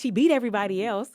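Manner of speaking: high, loud amazed exclaim; mock amazed boy. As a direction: play the stunned boy, lightly. Voices the boy's amazed remark.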